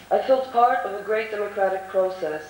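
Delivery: ordinary talk, nothing marked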